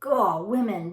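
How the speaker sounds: ordinary delivery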